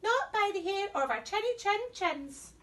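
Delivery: high, defiant little pigs. Acted. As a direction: squeak it defiantly.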